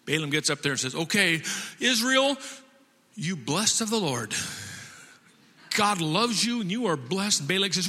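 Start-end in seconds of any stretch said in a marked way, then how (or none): 0.9-7.4 lightly acted solemn blessing; prophet's proclamation. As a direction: pronounce it over a whole nation. Balaam pronounces blessing over Israel.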